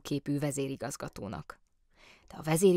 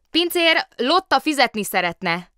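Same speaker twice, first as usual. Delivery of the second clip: loud, high-pitched call; shouting; 9 semitones higher, 10 dB louder than usual. A narrator's reading. Bear waving a paw at the waiter, shouting. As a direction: holler for the waiter. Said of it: "kiáltotta ekkor Kóbor Muki".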